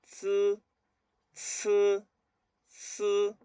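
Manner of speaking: isolated "ts" sounds with gaps for repetition; repeat-after-me drill. Shown as a demonstration. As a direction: sustain each "ts" with tongue at the teeth. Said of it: "Repitan después de mí".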